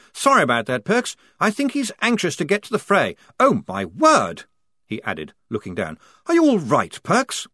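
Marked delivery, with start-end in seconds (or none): 0.1-3.1 higher, anxious officer voice; an earnest young officer apologising; fussy lieutenant. Acted. Lieutenant apologises to Perks, higher and fussy. 3.4-4.4 slow, shocked oh my word; drawn-out gasp of dismay. Acted. Lieutenant sees the bite, slowly dismayed. 6.3-7.5 worried lieutenant. Acted. Worried officer.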